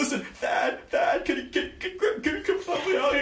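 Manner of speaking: very high stammering gnome plea; squeaky panicked gnome. Acted. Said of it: "he shouts out and goes".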